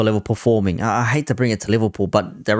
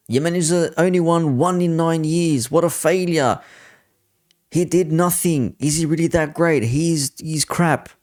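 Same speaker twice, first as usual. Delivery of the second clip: higher-pitched mimicry of jeering critics; mocking taunt; 7 semitones higher than usual. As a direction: imitate a pundit needling the fans. Voicing panelists' taunts at fans.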